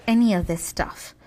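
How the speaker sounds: ordinary talk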